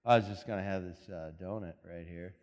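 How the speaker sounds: low, quiet guilty excuse; a touch of sheepish husband; sheepish mumble. Retold or imitated. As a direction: caught red-handed, mumbling. Caught husband sheepishly explaining.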